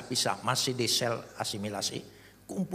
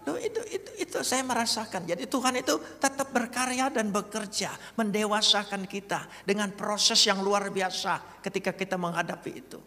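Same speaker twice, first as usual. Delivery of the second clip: much higher, earnest sermon voice; preaching; 12 semitones higher, 4 dB louder than usual. Acted. Preacher telling the congregation God works through hardship. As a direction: drive the lesson home. Preacher driving home lesson.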